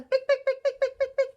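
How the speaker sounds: high, loud, quick pick-pick; running heels. Shown as a demonstration. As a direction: tap it out fast like hurrying heels. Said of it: "The lady starts to run".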